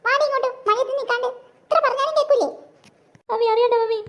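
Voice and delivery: an angry mum dragging her daughter in from the rain; a fed-up mother ordering her child home; scolding mother. Acted.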